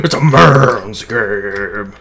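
slow, loud he-man drawl; mock macho voice. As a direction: ham up a tough guy, slowly. Macho game banter.

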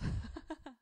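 high amused burst of laughter; a man laughing at the girl's answer; man's laugh. Acted. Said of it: "Flower Vase began to laugh".

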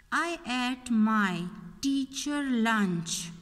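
slow, word-by-word reading of the wrong sentence; slow read-aloud. Shown as a demonstration. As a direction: spell each word out slowly for the class. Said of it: "Which word is extra here?".

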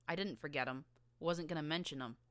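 plain reading voice

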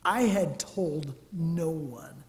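ordinary talk, nothing marked

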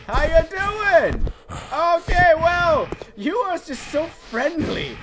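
high, slow and sweet, stretched out; sweet slow greeting. Pretend. Greeting the friendly monster, sweetly cooing. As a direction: coo it at the monster like a pet.

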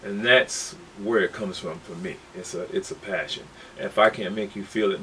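usual speaking voice

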